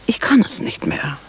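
leise, fast geflüstert, erschüttert; whisper. Acted. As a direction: sie bricht flüsternd ab. Schwester Maria, erschüttert, bricht ab. (erschüttert flüsternd)

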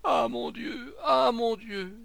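loud, high cry of despair; acted high-pitched lament; anguished wail. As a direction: wail and plead, drawing it out. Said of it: "en tordant ses bras".

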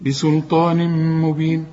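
بسلطان مبين chanted with a long hummed m; merge with ghunna. Shown as a demonstration. Teacher demonstrates the ghunna just named, held hum. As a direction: hold the joined meem of مبين through the nose. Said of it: "هنا غن بقى".

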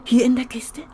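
whispered, eager question; whispered surprise. Acted. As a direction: hush it in disbelief. Surprised about photos in box.

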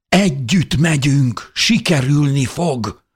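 high, loud, slow insistence; desperate shout. A narrator's reading. Narrator insists they escape together, desperately shouting. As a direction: hammer out each word desperately. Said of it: "kiáltottam kétségbe esetten".

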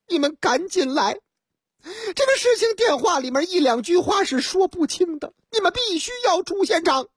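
woman urging the police; high woman caller. Acted. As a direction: beg them to come.

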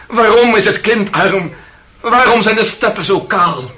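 anguished outcry. Acted. Accused crying out questions to the court. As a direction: build to a desperate plea.

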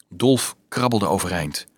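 plain reading voice

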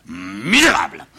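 drawn-out indignant exclamation; outraged curse. Acted. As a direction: stretch the insult in fury. Father curses rebellious son.

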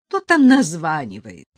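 loud, annoyed woman; wife annoyed at the early call, loud; displeased. A narrator's reading. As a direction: grumble loudly from the bed. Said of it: "недовольный женский голос".

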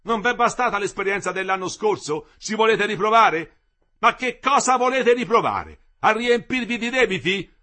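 indignant tirade. A narrator's reading. The engineer keeps berating the workers indignantly. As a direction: scold them for ingratitude.

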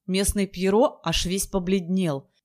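plain reading voice

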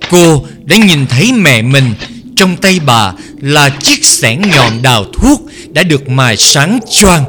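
plain reading voice

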